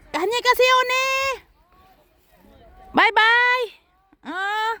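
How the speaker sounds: loud, very high call. Real, not acted. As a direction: call out brightly and wave goodbye. Calling out, high voice. (malakas na pagtawag)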